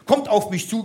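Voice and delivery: ordinary delivery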